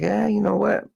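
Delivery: lightly put-on voice of scheming companies; imagined company bosses. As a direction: pitch it way up as a mock quote. Acting out companies' offhand decision.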